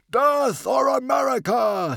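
very high, shouted mob reply; crowd scream. Acted. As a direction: scream it back at your leader. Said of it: "screamed the hysterics back".

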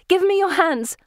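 high, urgent shout. A narrator's reading. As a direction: shout it as a pressing order. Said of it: "I shout".

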